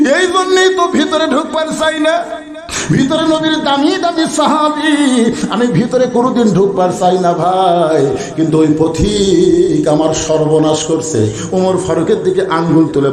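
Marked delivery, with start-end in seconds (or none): 0.1-11.3 high chanted wail, sinking to usual pitch; tearful lament. Acted. Shepherd trembling at his mistake, acted tearful lament. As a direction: start high and plaintive, then draw out the words. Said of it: "থরথর করে কাঁপে আর কান্দে".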